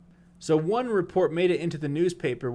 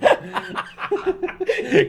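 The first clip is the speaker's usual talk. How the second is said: loud guffaw; 10 dB louder than usual. Real, not acted. Cracking up mid-read.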